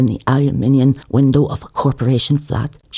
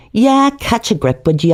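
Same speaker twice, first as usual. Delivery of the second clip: character retort; 9 semitones higher, 3 dB louder than usual. Acted. Narrator voices a character retort.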